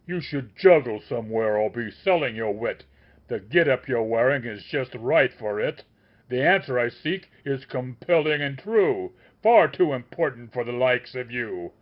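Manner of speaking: loud, contemptuous scolding; tongue-lashing. A narrator's reading. The master keeps scolding the wizard, loud. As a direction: lash him with scorn, loud. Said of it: "increasing the tongue-lashing pace".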